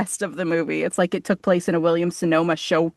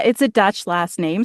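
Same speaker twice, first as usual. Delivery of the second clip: normal talking voice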